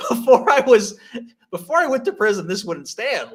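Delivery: high, loud and laughing; mock outrage through laughter; retelling subway outrage, high and laughing; laughing mock indignation. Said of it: "And I'm like".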